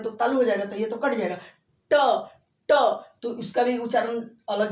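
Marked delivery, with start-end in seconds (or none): 1.9-2.7 ट repeated with a louder, higher retroflex tap; sounding out ṭa. Shown as a demonstration. Teacher to class, demonstrating ट to test its place. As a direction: tap out ट twice, crisp and loud. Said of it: "बोल के देखिए".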